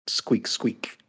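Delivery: breathy little squeak squeak; whispered squeak, squeak, like a mouse bike. Pretend. Bike named Squeeze, squeaky noise.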